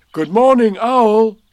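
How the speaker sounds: high, very loud hello; cheery called greeting. A narrator's reading. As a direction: call it out loudly to a neighbour. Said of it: "he said".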